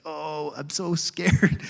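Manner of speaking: slow, drawn-out mock fear; mock scared cowering. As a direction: play a cowering dog, dragging the words out. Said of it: "he's got this shame and this fear".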